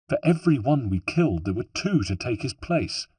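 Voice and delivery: usual speaking voice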